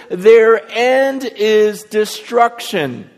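loud, high and drawn out; imitated anguished warning. Preacher voices Paul's tearful warning, loud retold anguish. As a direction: stretch the grim warning out slowly, pulpit-style. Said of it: "the reason why he's crying".

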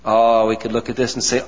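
usual speaking voice

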